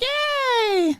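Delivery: very high, squealing cheer; a whole crowd yelling yay in delight; crowd's sky-high celebratory whoop. Acted. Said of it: "they all cheer and they're like".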